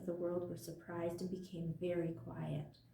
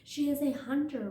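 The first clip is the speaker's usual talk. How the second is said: loud high roar of a proud lion; 8 semitones higher, 7 dB louder than usual. A narrator's reading. Lion roaring at the other creatures, loud and high. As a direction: belt it out like a lion. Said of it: "roared the lion".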